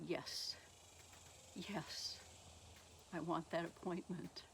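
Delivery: softly, with pauses between phrases; a quiet, retold yes; hushed acceptance. Caller accepting the doctor's appointment, hushed.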